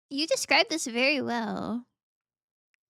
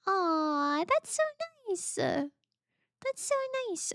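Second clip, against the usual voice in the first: high, slow drawn-out coo; 8 semitones higher, slower than usual. Real, not acted. Petting the animal in game, slow coo. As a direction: coo it like to a pet.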